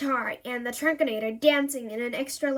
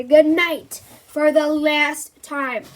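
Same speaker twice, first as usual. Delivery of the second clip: firm; 2 semitones higher, 9 dB louder, much slower than usual. A narrator's reading. Firm final goodnight.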